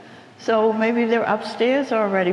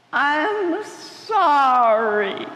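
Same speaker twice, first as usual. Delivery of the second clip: strained, drawn-out apology; 5 semitones higher, much slower than usual. Acted. Potato finally apologizing to Flamingo, slow and strained. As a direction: stretch it out very slowly, forcing the words.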